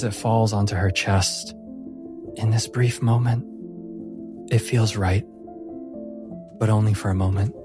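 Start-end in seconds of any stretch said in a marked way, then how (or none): none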